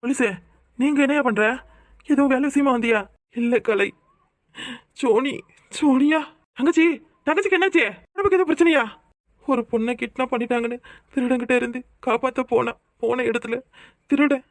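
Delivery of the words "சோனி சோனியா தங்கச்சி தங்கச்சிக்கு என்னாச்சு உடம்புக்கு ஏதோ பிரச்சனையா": alarmed worry. Acted. Brother hearing his sister is hurt, high anxious alarm. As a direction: panic rising in his voice.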